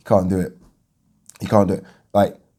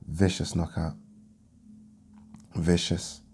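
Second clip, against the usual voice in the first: voice going; 4 semitones lower, 9 dB quieter than usual. Real, not acted. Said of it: "My voice is going".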